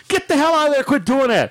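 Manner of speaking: high, exasperated wife impression; scolding wife. Wife rounds the corner on husband, mock scolding. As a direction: order him out in a mock wifely voice.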